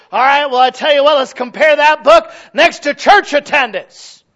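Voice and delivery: high, forceful preaching; loud challenge. Real, not acted. Preacher challenging boastful churches on attendance, loud and high. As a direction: call them out at full volume.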